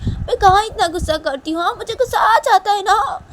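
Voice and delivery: put-on high, loud kid's self-defence; protesting child voice. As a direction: protest to grandma like an aggrieved child.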